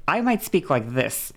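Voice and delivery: wavy pitch, rising and falling. Shown as a demonstration. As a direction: let the melody bounce around. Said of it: "It fucking bounces".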